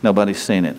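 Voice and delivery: ordinary talk, nothing marked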